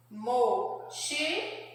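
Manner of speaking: "Mochila" slowly broken into three syllables. Shown as a demonstration. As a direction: say "Mochila" in three drawn-out, separate beats. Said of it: "três sílabas". ("Mochila" bem devagar, sílaba por sílaba)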